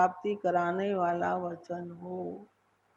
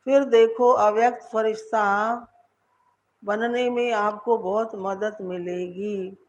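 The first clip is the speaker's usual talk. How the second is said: normal talking voice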